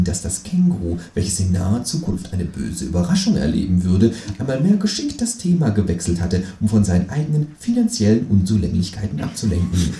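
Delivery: ordinary delivery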